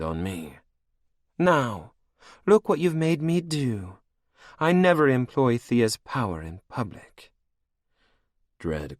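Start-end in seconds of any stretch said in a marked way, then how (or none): 1.4-7.3 high, cold and mocking; higher, menacing royal voice; villainess queen. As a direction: purr it over your captive like a cruel queen. Anne's threat.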